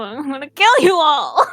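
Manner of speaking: normal talking voice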